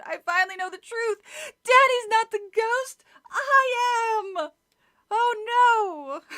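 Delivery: high, loud anguished cry; horrified cry. Acted. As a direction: cry it out in horror. Hannah's horrified realization, read dramatically.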